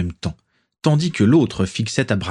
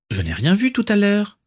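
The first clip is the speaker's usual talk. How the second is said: put-on much higher, a curious little girl; petite fille étonnée; 12 semitones higher than usual. A narrator's reading. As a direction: raise the voice like a puzzled child. Daisy, young girl, asks. (petite fille intriguée, voix bien plus aiguë)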